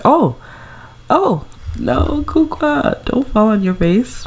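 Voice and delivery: high startled yelps, drawn out; alarmed cry. Real, not acted. Vlogger watching her sim topple, alarmed high cry. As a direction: squeal as she sees her fall. Sim falls during yoga.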